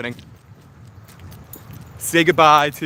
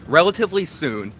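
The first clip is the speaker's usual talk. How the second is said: ordinary talk, nothing marked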